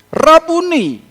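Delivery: astonished cry. Acted. Maria recognizes Jesus, cries out.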